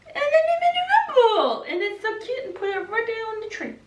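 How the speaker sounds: high, giggling through the words; giggly and delighted; laughing. Real, not acted. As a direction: laugh while talking about the cute ball. Laughing at the cute rum ball.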